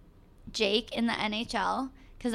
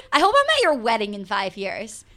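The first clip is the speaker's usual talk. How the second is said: excited; 3 semitones higher, 8 dB louder than usual. Real, not acted. Excited about friend's wedding.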